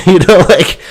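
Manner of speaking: normal talking voice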